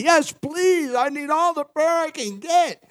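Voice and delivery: high-pitched, eager plea; eager acceptance. Acted. Preacher accepting someone's offer to pray for him, eagerly.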